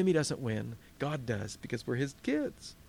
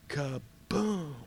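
kaboom; 4 dB quieter than usual. Acted. Enemy strikes.

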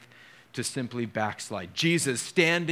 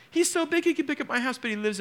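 much higher, fast, excited retelling of her words; marveling; 11 semitones higher, faster than usual. Retold or imitated. Pastor repeats his daughter's words to the church, marveling. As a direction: rush through it in delight.

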